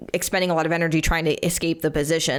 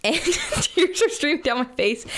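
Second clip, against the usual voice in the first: laughing; 9 semitones higher than usual. Real, not acted. Laughing while telling panic story.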